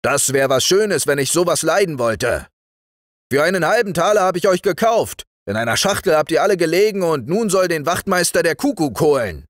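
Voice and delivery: fast, high, indignant scolding; loud strict rebuke, gathering speed; stern forester. Acted. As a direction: scold them as their outraged owner. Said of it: "sagte der Förster streng".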